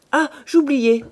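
Rougon's voice. Acted. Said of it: "reprit Rougon".

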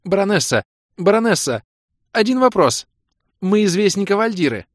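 high, loud eager shouts; calling out eagerly. A narrator's reading. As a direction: call out to get her attention.

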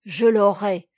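loud, determined declaration; roused, acted determination; growing animated. A narrator's reading. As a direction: declare it with firm resolve. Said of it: "reprit-il en s'animant".

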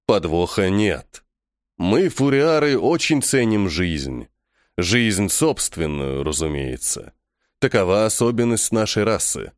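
low, slow, deliberate figure; measured low voice, spelling it out; composed alien captive. Acted. Vangur explains his race values life, slow and composed.

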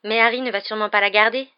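loud, sure of being right; dismissive assurance. A narrator's reading. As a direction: state it as if anything else were ridiculous. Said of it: "comme si l'idée lui paraissait ridicule".

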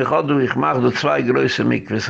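normal talking voice

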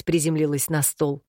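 usual speaking voice

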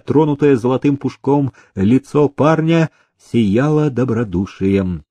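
plain reading voice

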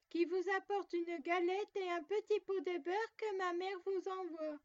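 sweet disguise the wolf keeps up; wolf faking a girl's voice. A narrator's reading. Wolf at the door posing as the girl, high put-on voice. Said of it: "en contrefaisant sa voix".

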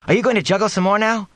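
put-on high, excited child's demand; eager demand. A narrator's reading. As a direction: pester him, eager and high. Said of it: "Eowyn demanded".

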